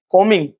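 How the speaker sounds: "कॉमिंग" with M straight into -ing; silent B. Shown as a demonstration. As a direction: say "कॉमिंग" with no B in the middle. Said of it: "कॉम में आईएनजी लगा देंगे".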